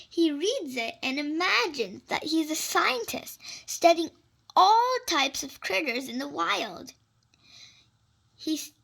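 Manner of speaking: usual speaking voice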